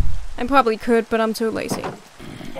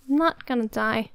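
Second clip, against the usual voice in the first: usual speaking voice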